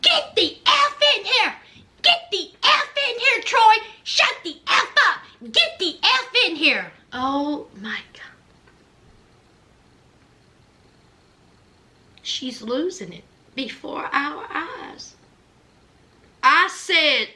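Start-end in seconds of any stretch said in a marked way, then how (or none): 0.0-6.9 screaming. Retold or imitated. Wife screaming at husband Troy to come in, raging. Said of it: "she is screaming". 7.1-15.2 stunned whisper. Real, not acted. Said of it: "stunned aside".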